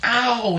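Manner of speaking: high, drawn-out acted "ow"; storyteller's mock cry of pain; yelp of pain. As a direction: yelp as if just cut. Cries out after cutting his skin.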